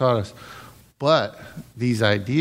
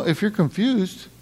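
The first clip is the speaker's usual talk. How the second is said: ordinary talk, nothing marked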